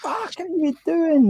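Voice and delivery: high-pitched, slow, aghast cry; shocked driver. Retold or imitated. Driver shouting at his mate who grabbed the wheel, high and aghast. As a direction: stretch it out in disbelief, voice jumping way up. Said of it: "And the driver's like".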